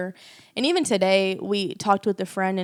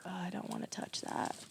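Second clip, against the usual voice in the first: whispering; 16 dB quieter than usual. Retold or imitated. Avoiding a painful inner feeling.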